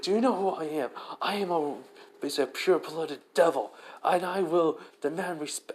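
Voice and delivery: enraged pure-blooded devil yelling demands; indignant shout. Acted. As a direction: rage at the insult, voice cracking up. Riser furious at being ignored.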